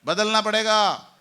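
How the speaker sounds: loud rallying shout. Shown as a demonstration. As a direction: shout it out to the hall. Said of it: "[name] बोलो ना जोर से".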